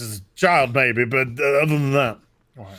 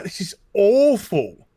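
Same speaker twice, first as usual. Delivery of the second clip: dragged-out, higher "awful", as if appalled; mock dismayed verdict; 5 semitones higher, slower than usual. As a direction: imitate panel members sounding appalled. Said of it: "they were just like".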